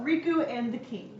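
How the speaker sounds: normal talking voice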